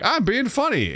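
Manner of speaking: high, silly mock self; squeaky wannabe joker; goofy teen voice. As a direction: play the dumb kid cracking a joke. Mock quote of his teen self.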